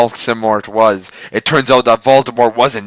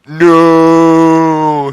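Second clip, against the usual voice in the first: long, high, loud scream; high, loud drawn-out scream; 6 semitones higher, 7 dB louder than usual. Acted. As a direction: wail it out in agony. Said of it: "he screamed".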